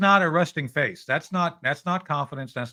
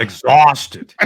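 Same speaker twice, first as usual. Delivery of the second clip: mock New York accent; 2 semitones lower, 11 dB louder than usual. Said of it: "He needs to do a New York accent".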